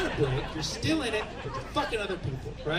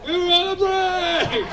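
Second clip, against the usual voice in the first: high, drawn-out indignant yell; hammed-up protesting holler; Ross; 11 semitones higher, 8 dB louder, slower than usual. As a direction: shout it like a sitcom catchphrase. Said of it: "It's the classic Ross and Rachel".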